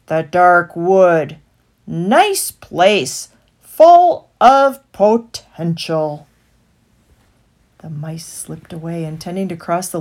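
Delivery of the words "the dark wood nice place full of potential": louder, slow wheedling rat; sly stallholder. A narrator's reading. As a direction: sell it to them. Rat selling the dark wood.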